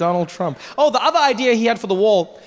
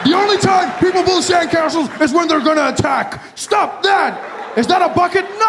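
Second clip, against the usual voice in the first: high, ranting yell; hammed-up military alarm, shouted high; mock furious shouting; 9 semitones higher than usual. America yells at a sandcastle builder, mock furious shouting.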